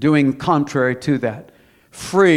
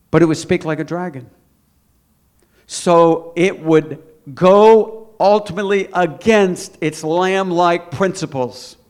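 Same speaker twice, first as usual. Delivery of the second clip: forceful preaching; 2 semitones higher, 5 dB louder than usual. Acted. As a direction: preach it out to the congregation.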